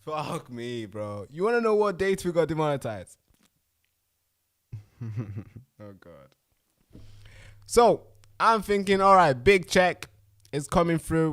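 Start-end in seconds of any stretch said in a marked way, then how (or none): 1.3-3.2 the question again, higher and louder, egging on; louder repeat. Real, not acted. Host to viewers, repeating the question louder. 7.6-11.3 loud, slow, higher burst of hope; excited. Retold or imitated. Host recalling expected payout, slow loud excitement.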